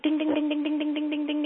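high, rapid sing-song dinging; imitated bell dinging. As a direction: ring it out like a little bell. Imitates a bell in his ear.

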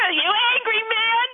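high, slow, drawn-out jeer; hammed-up cry at a stranger; mock road rage. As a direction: holler a comic insult. Mock yelling at other driver.

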